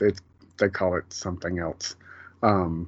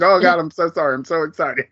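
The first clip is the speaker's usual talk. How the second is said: higher-pitched excited gush; retold excitement; 7 semitones higher, 5 dB louder, faster than usual. Player jumping on Ted in celebration, retold excited gushing. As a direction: gush an apology, imitating someone overexcited. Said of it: "jumping on Ted. He's like".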